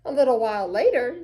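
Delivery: usual speaking voice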